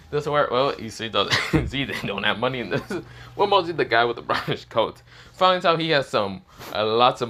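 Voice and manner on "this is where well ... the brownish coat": laughing while narrating; real laughing. Real, not acted. Narrator amused by Issei robbing the fallen, laughing. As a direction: let chuckles break up the telling.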